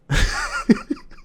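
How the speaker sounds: short high burst of laughter; a sudden laugh that bursts out; real laugh. Real, not acted. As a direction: narrator laughing at the memory. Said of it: "The laughing was me".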